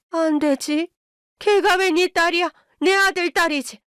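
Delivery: high, indignant retort; angry refusal. A narrator's reading. Mother-in-law claims her son's daughter, angry high refusal.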